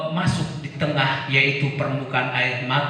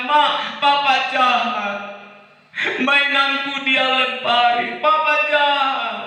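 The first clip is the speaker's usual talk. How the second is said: put-on child; 12 semitones higher, 3 dB louder than usual. Child complains to mother.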